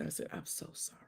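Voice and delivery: almost no voice at all, a breath of sorry; near-silent apology. Retold or imitated. On the phone to her crying friend, a near-silent sorry. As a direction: let it fade to nothing.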